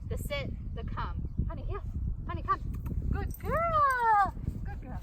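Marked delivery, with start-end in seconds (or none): 3.1-5.0 excited praise. Real, not acted. Owner praising her dog Honey with a high, loud, excited voice. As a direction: gush over her like a proud owner.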